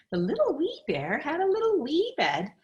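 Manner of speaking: tiny high voice. A narrator's reading. Reading to a child, little wee bear in a high voice. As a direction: lift up into a small high voice.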